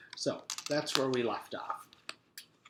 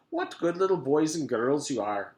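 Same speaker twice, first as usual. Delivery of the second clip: louder, slightly higher gloating voice; a hint of a creepy teacher; sinister teacher; 4 semitones higher, 5 dB louder than usual. A narrator's reading. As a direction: praise them with menace. Mister Gorf sinister.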